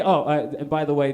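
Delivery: normal talking voice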